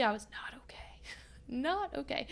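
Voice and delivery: hushed whisper with a small laugh. Real, not acted. Telling viewers she was not okay, in a whisper. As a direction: breathe the confession under your breath.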